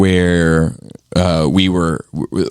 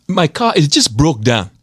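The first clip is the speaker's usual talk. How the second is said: mock African accent, much higher, with laughs; 12 semitones higher than usual. Rapping a lyric in his African accent for friends, mock African accent. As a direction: put on an African accent and drop a rap line. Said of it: "when I'm doing in the African accent".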